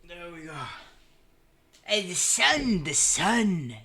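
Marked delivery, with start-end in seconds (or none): 1.8-3.7 drawn-out excited call; loud, slow delighted cry. Real, not acted. Excited at the sun in game.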